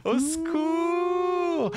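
very high, slow drawn-out wail; comic cry for help. Pretend. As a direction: wail it out like a damsel.

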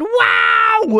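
high loud wow; thrilled yelp; excited shout. Real, not acted. Going down slide.

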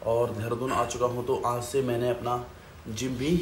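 normal talking voice

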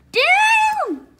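very high and loud as he leaps; shouted down. Acted. Roy jumping down, very high shout. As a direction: yell it mid-jump.

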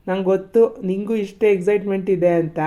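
normal talking voice

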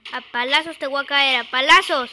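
loud fired-up yelling; battle shout. Real, not acted. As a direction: yell it while charging in. Charging into the fight.

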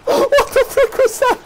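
high shrieking laughter; hysterical laughter. Real, not acted. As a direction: squeal through laughing. Hysterical at game chaos.

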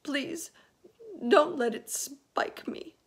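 high, pleading worm voice; frightened high-pitched begging. A narrator's reading. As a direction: plead loudly and helplessly. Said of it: "begged the earthworm".

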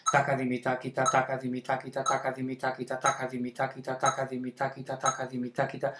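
soft monotone patter, four plus three; seven-note syllable count. Shown as a demonstration. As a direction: run the sevens without a breath. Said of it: "haría un takadimi y un takita".

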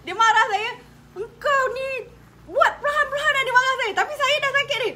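high, loud, half laughing through tears; real tears breaking through; crying while telling. Real, not acted. (menangis sambil bercerita)

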